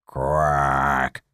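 low, drawn-out croaked quack; frog quack. Acted. The frog answers the calling woman with a croak.

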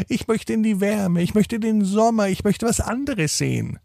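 high, soft little penguin voice; eager penguin longing for summer. Acted. Penguin speaks to Lennart. (hohe, leise Pinguinstimme)

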